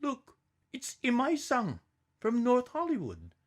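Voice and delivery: high, eager neighbor voice; excited neighbor. Acted. Voiced figure in read memoir.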